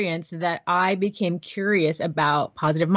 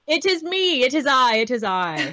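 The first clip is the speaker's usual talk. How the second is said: playfully theatrical self-introduction, starting high and stepping down; mock-grand announcement; 4 semitones higher than usual. Voiceover friend owning up to being the show's voice, mock-grand. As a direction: announce yourself with hammed-up grandeur.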